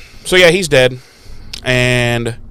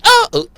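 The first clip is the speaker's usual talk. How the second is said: mock gasp; 3 dB louder than usual. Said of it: "he was like".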